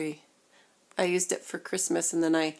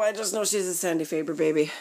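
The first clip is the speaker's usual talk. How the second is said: ordinary talk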